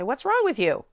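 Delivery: "What's wrong with you?" high and loud in mock exasperation; mock scolding. Teacher mimicking scolding a pupil, mock exasperated. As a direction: scold the student, half in jest. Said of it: "we say".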